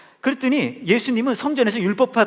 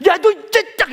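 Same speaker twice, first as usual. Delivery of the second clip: very high, loud scolding outburst; hammed-up angry parent; furious parent; 13 semitones higher, 5 dB louder, slower than usual. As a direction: act out a parent about to blow up. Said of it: "너무 화가 나서".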